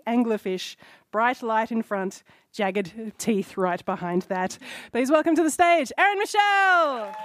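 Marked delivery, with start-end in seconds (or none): 4.9-7.2 high, loud stage announcement; excited host intro. Real, not acted. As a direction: bring her on with a big build. Host introduces next debater.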